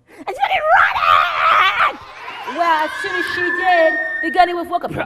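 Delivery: high, shouted, excited storytelling; full-blast frantic chase telling; screamed narration. A narrator's reading. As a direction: burst into a yell as the chase starts.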